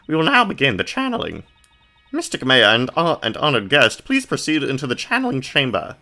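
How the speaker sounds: high, prim announcement voice; formal ceremony hostess. Acted. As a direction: usher the guests in with lofty formality.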